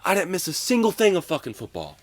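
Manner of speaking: imitated coach ranting at the player about his own kid; mimicking a ranting coach. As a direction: play the coach bragging he missed nothing. Coach berating him, retold.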